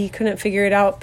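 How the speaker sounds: usual speaking voice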